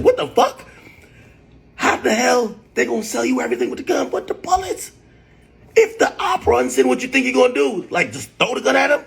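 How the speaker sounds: shrill high squeal of disbelief, pitch way up; retold outrage. Streamer reenacts telling Fresh off about missing bullets, in a high incredulous squeal. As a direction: act out baffled outrage, voice cracking high. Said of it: "I said".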